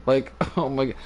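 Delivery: ordinary talk, nothing marked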